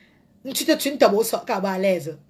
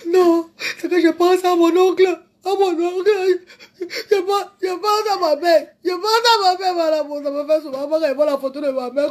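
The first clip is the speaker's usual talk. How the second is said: demonstrated crying; 5 semitones higher than usual. Pretend. Crying to madame over uncle and mother, mock bawling. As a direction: bawl through the words in a raised voice. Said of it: "comme ça, je pleure".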